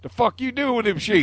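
mock a hillbilly catching someone. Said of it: "use it with a frickin' redneck".

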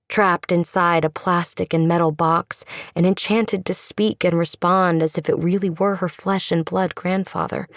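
plain reading voice

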